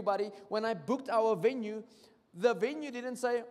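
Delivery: normal talking voice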